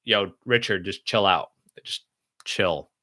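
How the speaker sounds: low, softened reminder to yourself; calming self-talk. Acted. Streamer reminding himself to relax when his chest is tight, calming. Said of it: "I need to remind myself to".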